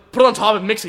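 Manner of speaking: high, loud mock-frantic order; blurted answer. Adrenaline-filled diner to waiter, loud blurted answer. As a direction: fire the answer out too loudly.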